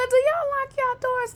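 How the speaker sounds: high-pitched retold question; high, disbelieving quote of oneself; incredulous question. Repeats own incredulous question.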